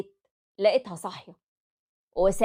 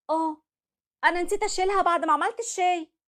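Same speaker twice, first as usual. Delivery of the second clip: wife's meek excuse; 10 semitones higher than usual. Acted. Wife answering her furious husband, meekly. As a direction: mumble the excuse, caught out.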